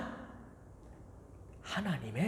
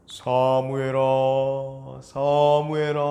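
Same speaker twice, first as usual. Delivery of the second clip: slow, loud calling of the name; calling voice of God; 2 semitones lower, 7 dB louder, much slower than usual. A narrator's reading. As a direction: call out the name from afar, drawn out. 하나님이 부름. (멀리서 부르는 하나님의 목소리)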